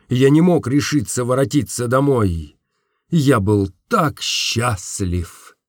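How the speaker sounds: ordinary delivery, nothing marked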